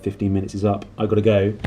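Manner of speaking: plain reading voice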